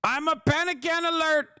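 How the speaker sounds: high, slow whiny panic voice; hammed-up panicky president; mock panicking Trump. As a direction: whimper it like a scared coward. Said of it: "He said".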